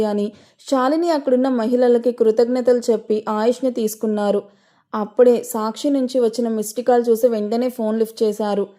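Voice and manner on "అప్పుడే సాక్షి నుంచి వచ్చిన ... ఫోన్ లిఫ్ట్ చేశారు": ordinary delivery, nothing marked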